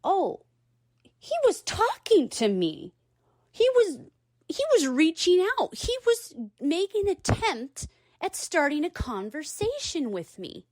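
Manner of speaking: high, rising sudden realization. Retold or imitated. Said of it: "it dawned on me".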